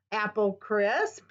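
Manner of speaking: normal talking voice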